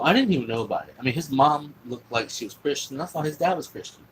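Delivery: ordinary talk, nothing marked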